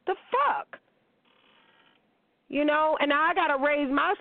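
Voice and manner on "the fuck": high, incredulous "The fuck?" squeaked up; sharp high-pitched disgusted question; outraged disbelief. Real, not acted. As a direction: jump way up in disbelief at the idea. Outraged rant about grown men.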